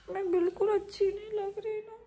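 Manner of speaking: mock-crying about her looks; whiny cry. Real, not acted.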